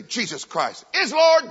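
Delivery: slow start swelling into a loud proclamation; finishing someone's curse. Retold or imitated. Believer completing a stranger's sentence, loud and proud. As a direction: turn the oath into a ringing declaration. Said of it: "I add the rest of the sentence".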